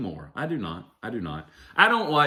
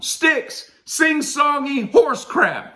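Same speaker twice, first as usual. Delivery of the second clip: high, slow proclamation; mock album title; much slower than usual. Naming their debut album, high slow mock announcement. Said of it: "what they should have named their debut album".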